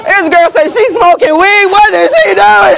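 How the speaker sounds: loud, high, hammed-up panic; frantic shouting. Mother prays about son's girlfriend, frantic shouting. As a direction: holler it to God, aghast. Acted frantic prayer on the floor.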